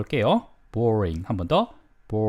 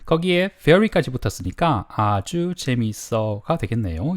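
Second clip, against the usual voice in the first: normal talking voice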